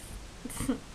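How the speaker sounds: quiet airy chuckle; soft breathy laugh. Real, not acted. Laughs at tomato face.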